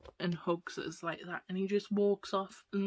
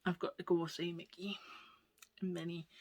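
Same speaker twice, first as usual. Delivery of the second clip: ordinary talk, nothing marked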